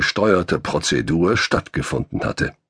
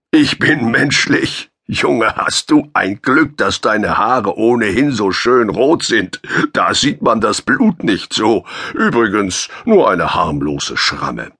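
a hint of a big easygoing man, slow and amused; relaxed giant teasing the wounded young man, slightly higher and slower; lightly voiced cheerful giant; 5 semitones higher, 4 dB louder than usual. A narrator's reading. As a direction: play the friendly giant, unbothered by the blood. Giant character voice.

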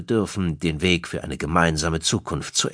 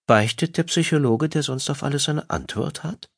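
higher-pitched, smug teasing question; 9 semitones higher than usual. A narrator's reading. Wife mocks her psychologist husband, smug and higher. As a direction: needle him with self-satisfied mockery, pitched up. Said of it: "sagte Karen suffisant".

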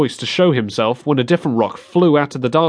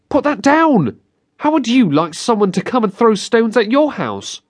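put-on scolding girl; 10 semitones higher than usual. A narrator's reading. Girl scolding Billy for throwing rocks, angry high voice. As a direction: tell him off like a big sister.

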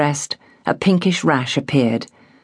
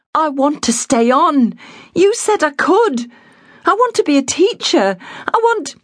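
put-on a girl fighting for her dream; defiant girl; 12 semitones higher than usual. A narrator's reading. Girl defying her father, higher and insistent.

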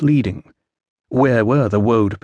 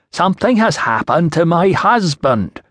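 put-on slow, higher, insistent Iceni accent; louder, accented repeat; 6 semitones higher than usual. A narrator's reading. Woman repeats her trouble to a baffled official, insistent and accented. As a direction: press each word on him, a little louder, accented. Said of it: "louder rather than slower".

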